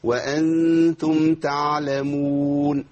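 slow sung تعلمون with a relaxed, audible ع; easy clear ayn. Shown as a demonstration. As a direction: chant تعلمون, letting the ع flow effortlessly. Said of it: "هي أسهل من كده خالص".

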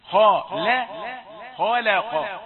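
خلق with heavy خ and ق but a thin lam; light lam. Shown as a demonstration. Teacher showing the correct thin lam in خلق. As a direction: shift the mouth so the lam in خلق stays light. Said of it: "تعطي نفسك فرصة لتغيير فتحة الفم".

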